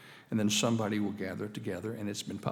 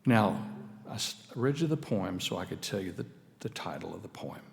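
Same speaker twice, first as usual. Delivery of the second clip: normal talking voice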